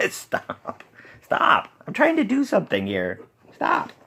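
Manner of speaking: high, exasperated pleading with pets; real fed-up squeal at interruptions; exasperated scolding. Real, not acted. Scolding pets disrupting the recording.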